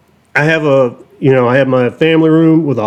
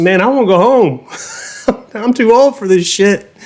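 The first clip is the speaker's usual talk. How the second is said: weary high exclamation; 7 semitones higher than usual. Retold or imitated. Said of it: "I said".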